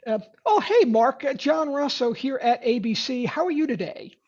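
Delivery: high, bright-sounding cold call with rising tune; chirpy rep sounding too eager; upbeat salesy pitch. Shown as a demonstration. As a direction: overeager salesman on the phone. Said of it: "I'll demonstrate it".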